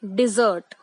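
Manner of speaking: dessert said "डिज़र्ट", stress on the second syllable; teacher's model: "dessert" as "डि-ज़र्ट"; correct pronunciation. Shown as a demonstration. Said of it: "इस word को हम बोलते हैं". (सही उच्चारण: dessert को "डिज़र्ट" बोलें)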